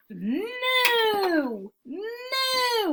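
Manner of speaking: long, high swooping moo; loud cow moo. Acted. Teacher reads the noisy cow to children, high long mooing. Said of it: "went the cow".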